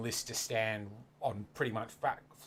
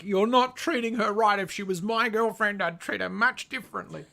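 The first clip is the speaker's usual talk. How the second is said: higher and louder, hammed-up indignant commenter; 8 semitones higher, 6 dB louder than usual. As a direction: play the outraged self-appointed protector. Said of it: "so many people are like".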